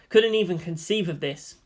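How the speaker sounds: ordinary delivery, nothing marked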